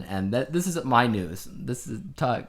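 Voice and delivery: normal talking voice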